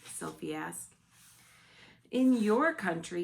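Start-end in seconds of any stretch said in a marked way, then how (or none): 2.1-3.2 loud, higher BFG; the BFG declaring loudly; louder giant. A narrator's reading. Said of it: "he said".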